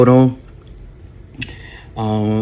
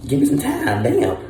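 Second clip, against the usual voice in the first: exasperated outburst; 6 semitones higher, 4 dB louder than usual. Retold or imitated. Complaint about waking too fast.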